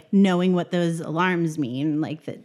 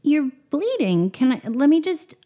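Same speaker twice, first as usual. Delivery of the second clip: higher, bright helpful character voice; eager helper voice; 9 semitones higher, 5 dB louder than usual. Acted. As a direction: rush in to help, a touch anxious. Player's character offers help eagerly.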